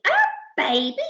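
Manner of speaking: rising, questioning echo; incredulous yell. Acted. Crew echoing Percy's news, incredulous question. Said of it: "yelled the crew".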